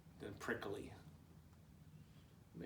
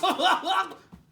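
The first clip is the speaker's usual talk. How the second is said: 1 high cackling laugh; 12 dB louder than usual. Acted. Jackrabbit laughs.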